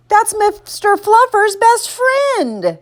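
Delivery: high, slow, dragged-out protest; aghast child. A narrator's reading. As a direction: plead for the toy in a little-boy wail. Boy aghast at losing toy.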